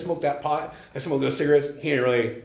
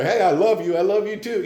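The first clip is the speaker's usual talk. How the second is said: higher, gushy pretend exchange; mock gushing affection; 6 semitones higher, 4 dB louder than usual. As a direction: parody two people trading I-love-yous. Mimes an openly affectionate exchange.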